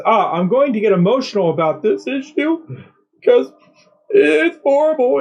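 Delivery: imitating a fake tearful voice, pitch climbing; mocking her fake emotional plea; mock sobbing. Vlogger mocking press secretary's fake tears, mock sob. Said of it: "starting to audibly sob".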